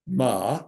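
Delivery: "ma" in a dipping third tone, meaning horse; Mandarin tone demo. Shown as a demonstration. Lecturer demonstrating the Mandarin tone for horse. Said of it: "it can mean one of five things".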